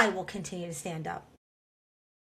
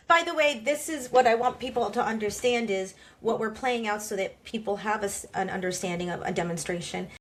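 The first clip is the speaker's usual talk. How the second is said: ordinary talk, nothing marked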